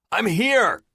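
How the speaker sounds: man shouts down the shaft to the trapped woman; screaming back. A narrator's reading. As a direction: yell so she can hear. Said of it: "I scream again".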